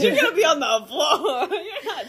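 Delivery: high, loud, laughing scold; laughing refusal. Retold or imitated. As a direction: refuse her through laughter. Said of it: "She was like".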